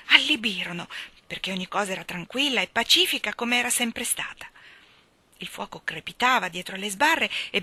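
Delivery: ordinary delivery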